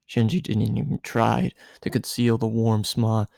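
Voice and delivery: ordinary delivery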